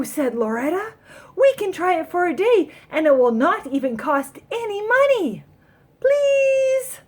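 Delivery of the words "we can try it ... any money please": put-on high, loud begging child; pleading child. Girl begging her mother for the chair, high pleading. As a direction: beg like a kid who wants it badly.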